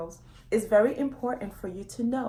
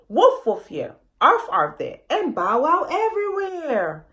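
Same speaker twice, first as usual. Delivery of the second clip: loud, higher, sing-song woofs and arfs; mock dog barking; 5 semitones higher, 5 dB louder than usual. A narrator's reading. Elephant family calling for lost puppy, playful loud barking. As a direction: bark it out like a big family calling a lost pup.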